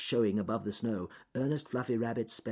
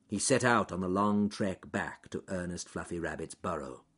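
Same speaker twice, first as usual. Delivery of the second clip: ordinary delivery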